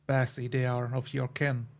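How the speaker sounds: ordinary delivery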